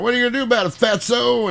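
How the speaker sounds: high taunting southern yokel; southern yokel accent. Retold or imitated. Yokel taunting the fat deputy, southern accent. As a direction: jeer as a mock southern barfly. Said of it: "I love doing these southern yokel accents".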